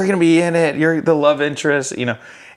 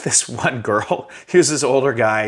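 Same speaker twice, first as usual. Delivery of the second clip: normal talking voice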